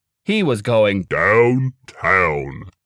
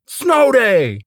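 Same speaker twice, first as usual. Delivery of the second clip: high, loud joyful shout; excited shout; 12 semitones higher, 5 dB louder than usual. Acted. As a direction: yell it like great news. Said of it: "he shouted".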